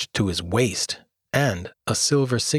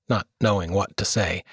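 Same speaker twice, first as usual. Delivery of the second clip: usual speaking voice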